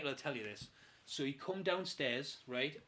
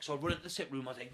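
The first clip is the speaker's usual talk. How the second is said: usual speaking voice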